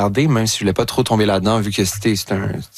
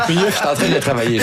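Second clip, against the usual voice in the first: higher, through real laughter; laughing protest; 5 semitones higher than usual. Real, not acted. Actor teased about his French accent, laughing. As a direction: laugh while defending yourself.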